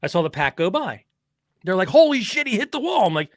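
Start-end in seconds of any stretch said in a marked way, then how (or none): 1.9-3.1 high-pitched shocked report; mock excited crowd cry; imitated screaming crew. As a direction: relay a crash like a panicked onlooker. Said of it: "they're all screaming".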